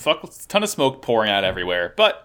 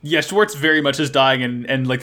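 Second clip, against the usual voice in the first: normal talking voice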